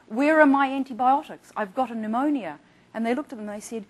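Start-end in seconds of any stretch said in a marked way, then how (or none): 0.1-2.6 retold demand. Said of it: "He said".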